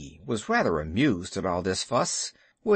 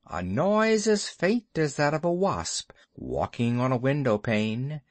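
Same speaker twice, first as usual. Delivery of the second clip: ordinary delivery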